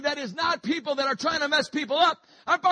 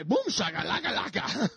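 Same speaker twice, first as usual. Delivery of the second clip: lower, nonsense babble; mock tongues babble; 5 semitones lower than usual. Preacher joking to the church, mock tongues. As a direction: jokingly fake speaking in tongues. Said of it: "That wasn't tongues".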